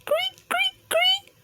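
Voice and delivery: bedsprings creaking high; high squeaky creaks. Acted. Mouse's bed rattling, high squeaky creaking.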